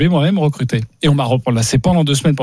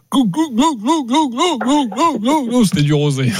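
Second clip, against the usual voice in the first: high gulping glou glou, much higher pitch; gulping noises; 9 semitones higher than usual. Retold or imitated. Telling the night-fridge story, high mock gulping. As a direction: imitate chugging a bottle, high gulps. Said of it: "gulping sounds in a story".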